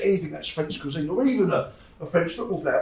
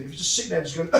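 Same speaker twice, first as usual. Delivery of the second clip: ordinary talk, nothing marked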